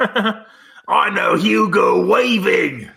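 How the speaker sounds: drawn-out, high mock Australian shout; hammed-up Australian accent, very slow and high; bad Australian accent. Host botches an impression of the actor, bad Australian accent. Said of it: "I can't do an Australian accent".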